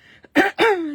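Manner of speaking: sharp, loud throat-clearing coughs; real coughing fit. Real, not acted. Crafter coughing mid-demo with a frog in her throat, loud coughs. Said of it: "J'ai un chat dans la gorge".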